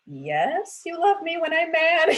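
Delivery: put-on high, loud sing-song child reply; mocking kid voice. Mom imitating her kids teasing her, high and loud. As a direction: play the cheeky kid rubbing it in.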